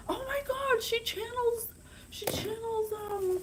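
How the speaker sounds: overjoyed fan gushing about the author; excited gush. Real, not acted. Excited fangirling.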